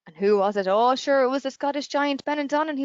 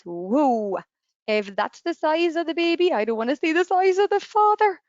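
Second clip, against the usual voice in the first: higher, louder alarmed exclamation; frightened giant; 5 semitones higher, 4 dB louder than usual. Acted. Scottish giant seeing the huge baby, acted alarm. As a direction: recoil in hammed-up fright, building louder. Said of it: "he got the fright of his life".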